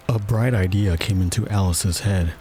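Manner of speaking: ordinary delivery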